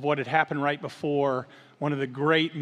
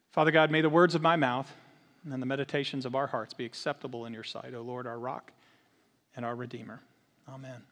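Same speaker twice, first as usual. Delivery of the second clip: prayer; 2 semitones lower, 4 dB quieter than usual. Real, not acted. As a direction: pray quietly, settling down to a hushed amen. Leads congregation in prayer.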